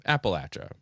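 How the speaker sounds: Appalachia said "app-uh-LATCH-uh"; short-A pronunciation. Shown as a demonstration. Host returning to first option, Appalachia as "app-uh-LATCH-uh". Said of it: "It's pronounced either way".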